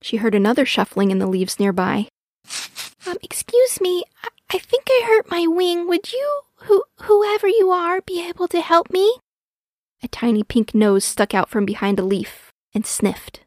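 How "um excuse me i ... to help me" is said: high, hesitant little voice; timid baby owl. A narrator's reading. Hurt baby owl asking a stranger for help, timid. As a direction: plead shyly in a high child voice.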